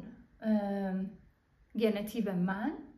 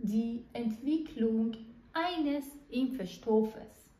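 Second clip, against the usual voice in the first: slow dictation; much slower than usual. Shown as a demonstration.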